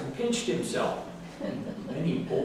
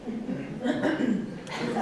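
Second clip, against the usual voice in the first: laughing; 10 semitones higher, 3 dB quieter than usual. A narrator's reading. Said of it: "laughed very loud".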